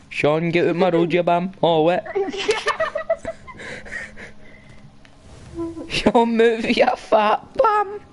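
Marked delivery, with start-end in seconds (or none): 2.1-4.4 squealing giggle fit; high shrieking laugh. Real, not acted. Cracking up at the game. 5.9-8.0 high, slow mocking squeal; squeaky taunt. Taunting friend in game.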